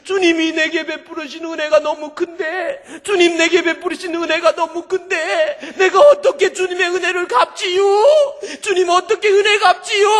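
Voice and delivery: high, loud sobbing shout, acted out; imitated bawling believer; wailing tearful prayer. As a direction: build the weeping plea into a full wail. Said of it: "엉엉 울다가".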